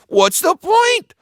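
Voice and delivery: very high, loud complaint; whining pirate. A narrator's reading. Disheartened pirate complaining to Joe, high. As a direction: protest the pointless fight.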